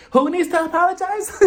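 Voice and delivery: high, sharp mock-demand; mock indignant [name]. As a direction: pounce on the question, pretending outrage.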